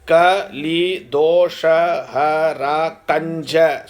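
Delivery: "Ka, Li, Do, Sha, Ha, Ra, Kanja" counted out one syllable at a time; Ka... Li... Do... spaced out deliberately up to Kanja. Shown as a demonstration. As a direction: separate each syllable from Ka to Kanja with a small gap. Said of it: "when you come to the eighth syllable".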